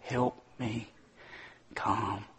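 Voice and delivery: hushed, slow plea for calm; quiet prayer. Shown as a demonstration. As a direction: pray it down under your breath. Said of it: "Just slow down and say".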